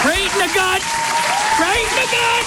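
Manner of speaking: high, strained, hammed-up emotion; squeezed high voice, as if near tears; mock choked up. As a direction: play at being hit hard by feelings. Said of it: "I'm gonna cry".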